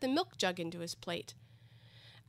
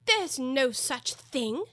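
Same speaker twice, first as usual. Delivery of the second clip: louder, higher, slow indignant protest; very angry; 5 semitones higher, 5 dB louder, much slower than usual. A narrator's reading. Alice disputes the treacle well, angrily. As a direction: object firmly, word by word. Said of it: "beginning very angrily".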